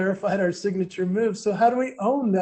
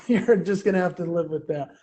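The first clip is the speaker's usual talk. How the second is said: ordinary talk, nothing marked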